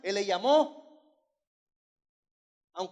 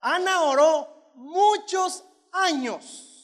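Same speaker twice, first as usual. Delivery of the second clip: slow, loud preacher's cry; slow and louder, hammered home; preaching shout; 7 semitones higher, 9 dB louder, much slower than usual. Real, not acted. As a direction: drag each word out loud for the crowd. Preacher proclaiming to congregation.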